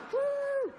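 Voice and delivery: high, loud woo; excited whoop. Retold or imitated. Comic imitating the dog's joyful welcome, a high loud whoop. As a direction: cheer like a party.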